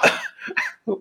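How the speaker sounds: short high laugh falling away; burst of laughter. Real, not acted. Storyteller laughing at his own joke, amused. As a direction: let a laugh burst out and fall.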